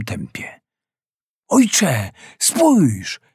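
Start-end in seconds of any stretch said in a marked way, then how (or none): 1.5-3.2 higher urgent cry to father; shout. A narrator's reading. Son spotting something in the sea, alarmed cry. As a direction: call out in alarm. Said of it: "krzyknął".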